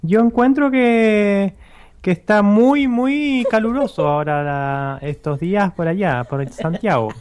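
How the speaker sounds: Chilean accent. Shown as a demonstration. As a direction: drag it out like a Santiago local. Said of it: "habla como un chileno".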